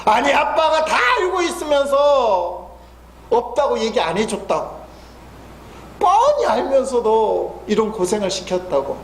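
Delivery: imitating a cross child, high and shouting at first; a six-year-old blaming dad, pitched high; angry child. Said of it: "이 애가 갑자기 화를 내는 거예요". (아빠 탓하는 아이를 높은 목소리로 흉내 내며 화내기)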